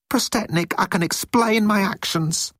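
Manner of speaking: high-pitched underling voice; eager pleading. A narrator's reading. Moan has a way to explain himself, high and eager.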